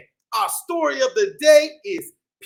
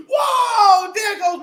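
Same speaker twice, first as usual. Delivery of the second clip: a high, loud whoa; mock amazement; 7 semitones higher, 4 dB louder, slower than usual. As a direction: act amazed for the kids. Amazed at bee puppet.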